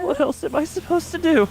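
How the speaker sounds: high, strained anguish; anguished cry. Acted. Yuri cries out her guilt at leaving them, high. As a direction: plead against the guilt. Voicing Yuri's anguished defence.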